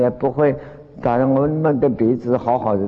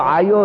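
a sharp, higher "ouch" cry, half acted; mock yelp of pain; 10 semitones higher, 4 dB louder than usual. Master retelling the disciple's cry as his nose is twisted, mock yelp. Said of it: "等他叫".

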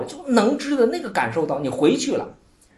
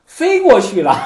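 disciple's naive answer; 9 semitones higher, 6 dB louder, slower than usual. Retold or imitated. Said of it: "这百丈说".

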